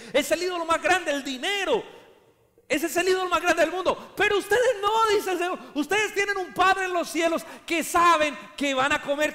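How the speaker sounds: high, loud preaching; fiery preaching. Acted. Preacher exhorting the church, high and loud. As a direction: preach with rising fire.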